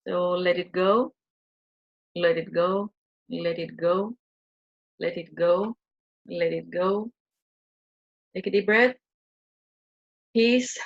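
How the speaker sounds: gentle guided-meditation chant; soothing guided release. Acted.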